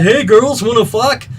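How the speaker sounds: put-on crude young guy. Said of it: "these boys doing exactly basically that".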